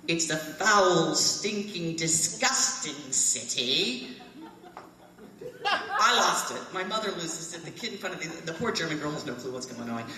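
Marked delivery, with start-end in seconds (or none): 0.1-4.1 put-on slow, louder British accent, relishing each insult; British accent, punchline. The other British man's sudden verdict on the city, slow and louder. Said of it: "They talk just like that". 5.7-6.6 high laugh bursting out. Real, not acted. Reliving cracking up at the British men, laughing out loud.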